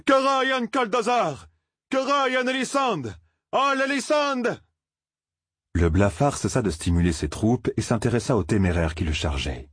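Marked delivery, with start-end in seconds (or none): 0.0-4.4 high, drawn-out battle cries; Matt's war cry. A narrator's reading. Young fighter charges with his own cry, shouting high. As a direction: hurl out each cry, high and long. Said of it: "Matt se distingua en lançant".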